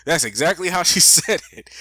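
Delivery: laughing through "That's exactly how she said it"; real laugh breaking into the words. Real, not acted. Laughing at senator's drawl.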